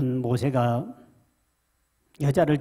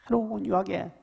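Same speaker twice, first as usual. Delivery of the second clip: usual speaking voice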